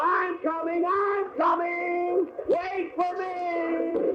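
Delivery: high, slow, stretched yells while running; panicked shout. Acted. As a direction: cry out chasing the train. Running to catch the train.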